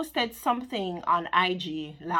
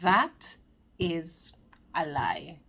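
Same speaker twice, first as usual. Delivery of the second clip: that, is, a lie, each word apart, dropping to a whispered is; slow emphatic "that is a lie", whispered "is"; 3 semitones lower, much slower than usual. Shown as a demonstration. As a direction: lean in and spell out "that is a lie" word by word. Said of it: "looking straight in your eye".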